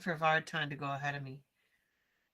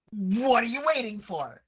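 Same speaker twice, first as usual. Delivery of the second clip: higher, louder prodding question; impatient; 5 semitones higher, 7 dB louder than usual. Acted. Caster staring at companion by the locked door, impatient. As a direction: urge him on with wide-eyed exasperation. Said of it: "I'm gonna stare at him with really big eyes".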